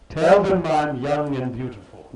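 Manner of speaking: put-on sly old woman's parting words, lightly done; old hag's voice. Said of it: "the old hag smiles and says".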